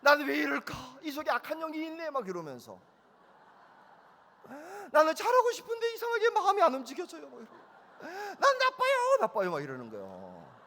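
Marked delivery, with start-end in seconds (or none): 0.0-2.1 high loud mock lament; hammed-up repentance. Son hamming up repentance. 4.9-7.3 high loud laughing-crying plea; mock crying wail. Son wailing repentance. 8.0-9.5 loud slow high wail; mock wailing. Son wailing.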